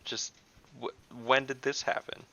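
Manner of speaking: ordinary talk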